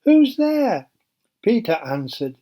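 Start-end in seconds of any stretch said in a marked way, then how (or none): 0.0-0.9 high, wary call through the door; called out nervously. Acted. Rhoda calling through the door at night, high and wary. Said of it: "She crept towards the door and called".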